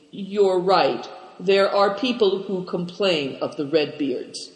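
usual speaking voice

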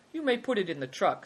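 high, gracious permission; lifted, lordly consent. A narrator's reading. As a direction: grant it graciously. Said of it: "said Good Fortune graciously".

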